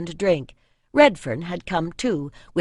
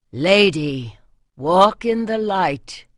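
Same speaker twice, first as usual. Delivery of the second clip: respectful greeting; 5 dB louder, slower than usual. A narrator's reading. Smith greets the priestess, bowing.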